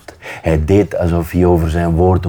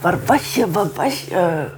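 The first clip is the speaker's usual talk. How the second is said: put-on very high, soft baby-talk to a dog; small, high, doting voice; tender cooing; 12 semitones higher, 5 dB quieter than usual. A narrator's reading. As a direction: coo it over and over to a beloved pet. Boy fusses over found dog.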